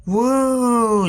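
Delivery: high-pitched drawn-out "woo"; mock wailing hoot. A narrator's reading. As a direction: let out a high, long woo.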